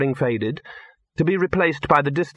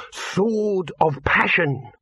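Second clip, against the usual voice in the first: slow, higher-pitched reverent proclamation; grand title announcement; 8 semitones higher, much slower than usual. A narrator's reading. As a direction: unveil the title grandly, drawing it out. Dibbler announces grand film title.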